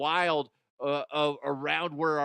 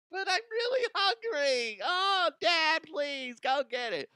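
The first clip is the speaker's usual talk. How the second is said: put-on much higher, pleading kid voice; whiny kid voice; 13 semitones higher than usual. Kid begging dad to go get food, high pleading whine. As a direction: beg like a hungry child.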